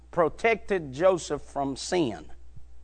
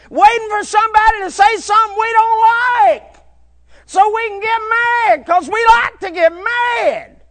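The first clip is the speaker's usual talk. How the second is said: higher and louder, near-shouted sermon climax; preaching at full force; 14 semitones higher, 11 dB louder than usual. Acted. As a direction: drive it home to the congregation.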